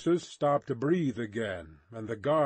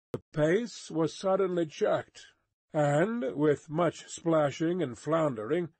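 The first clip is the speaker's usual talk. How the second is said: ordinary delivery, nothing marked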